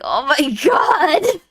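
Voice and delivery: slow, loud laughing cry; laughing shout. Real, not acted. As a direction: burst out laughing. Laughing at the sad picture.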